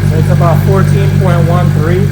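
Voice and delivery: loud, reading out a meter value. Real, not acted. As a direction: call the number over engine noise. Reading voltage, engine running.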